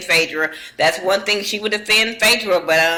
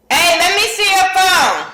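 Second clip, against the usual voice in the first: higher, louder call across the room; 10 semitones higher, 4 dB louder than usual. Real, not acted. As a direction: call over to someone nearby. Calling out to someone in room.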